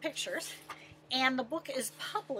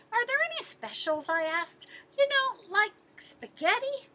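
put-on higher, quick hopeful kid voice; eager boy voice; 8 semitones higher than usual. Boy asking the lion for spaghetti, high and eager. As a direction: ask like a hopeful little boy.

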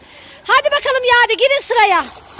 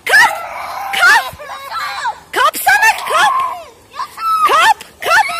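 high, insistent calling out; high-pitched repeated commands; insistent urging; 6 semitones higher than usual. Real, not acted. Urging child to grab behind him.

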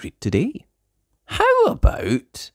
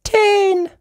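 high, louder reveal; excited reveal; 7 dB louder than usual. Acted. Reader revealing the rabbits, excited. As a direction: finish with a big cheer. Climactic count.

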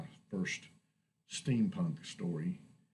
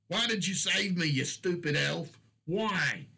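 much higher, louder desperate screaming; anguished scream; 10 semitones higher, 6 dB louder than usual. Acted. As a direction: scream at the dead elf in grief. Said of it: "Kurlak screamed at it".

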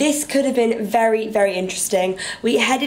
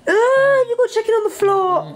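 high excited squeal; shriek; 11 semitones higher, 3 dB louder than usual. Real, not acted. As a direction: squeal at the mess. Shrieking at chicken mess.

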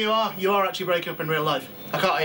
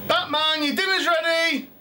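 high sing-song mum calling; calling kids to dinner; 10 semitones higher, slower than usual. Acted. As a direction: call out like a mum at teatime. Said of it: "how does Batman's mum call Batman in".

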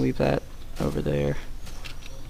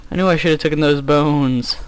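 ordinary talk, nothing marked

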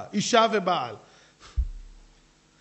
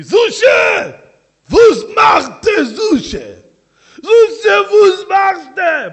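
thundering Yiddish shout, much higher and louder; heavenly call; 12 semitones higher, 17 dB louder, slower than usual. Acted. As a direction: boom the name like a heavenly summons. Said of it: "לא ישאלו אותי".

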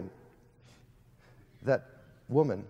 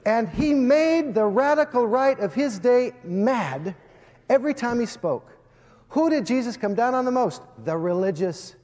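preaching at full force; 8 semitones higher, 7 dB louder than usual. Acted. Preacher rousing Texas A and M students, preaching at full force. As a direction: preach it out to the whole hall.

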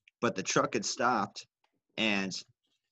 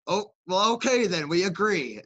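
higher, louder hammed-up concession; mock grudging agreement; 8 semitones higher, 5 dB louder than usual. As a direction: play both sides settling it grudgingly. Mock agreement between rivals.

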